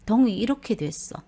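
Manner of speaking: usual speaking voice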